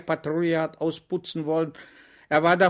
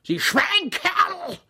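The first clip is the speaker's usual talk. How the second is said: much higher, very loud roaring insult; bellowing; 4 dB louder, much slower than usual. A narrator's reading. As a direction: roar at him in outrage, dragging it out slowly. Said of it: "brüllte ihn an".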